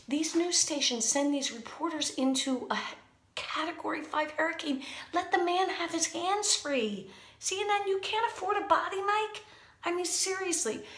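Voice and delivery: rising outrage at cheap news networks; indignant rant. Real, not acted.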